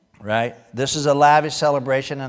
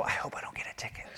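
quick unvoiced whisper; whispered worry; 16 dB quieter, faster than usual. Speeding driver whispering a quick worried hope. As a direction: hiss it fast like a nervous speeder. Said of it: "go really fast and go".

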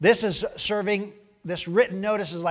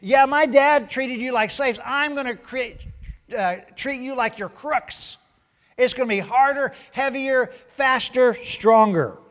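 higher and louder mock king boast, building to stronger; bragging threat; 7 semitones higher, 6 dB louder than usual. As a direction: swagger and escalate each word. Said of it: "he's saying".

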